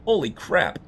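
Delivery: ordinary delivery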